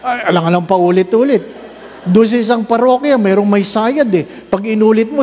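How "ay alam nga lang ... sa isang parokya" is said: usual speaking voice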